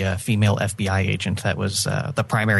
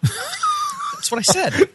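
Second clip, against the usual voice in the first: mock innocent claim; much slower than usual. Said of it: "No, it's not".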